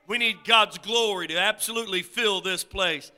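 rising revival proclamation; full-force preaching. Real, not acted. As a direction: drive each phrase home to the congregation.

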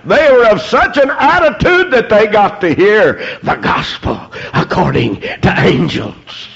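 usual speaking voice

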